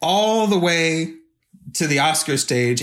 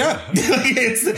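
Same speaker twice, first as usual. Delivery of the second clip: laughing; 11 semitones higher than usual. Real, not acted. Laughs at own bully remark.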